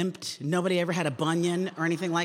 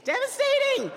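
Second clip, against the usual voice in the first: Devastating wailed in a huge high swoop, louder; 4 dB louder than usual. Shown as a demonstration. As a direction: cry out devastating like a mock tragedy.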